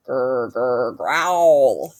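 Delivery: low rumbling growl. A narrator's reading. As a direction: growl like a hidden beast. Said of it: "The growling got louder".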